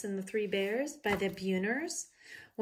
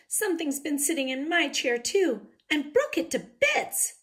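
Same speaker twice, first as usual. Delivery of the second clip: little bear cub; 7 semitones higher, 6 dB louder than usual. A narrator's reading. Cub voice again.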